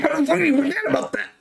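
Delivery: fast, high disbelief; a rushed, squeaky how-could-you; incredulous. Retold or imitated. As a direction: fire it off in rapid disbelief. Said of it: "It's like".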